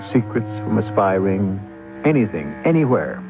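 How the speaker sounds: ordinary talk, nothing marked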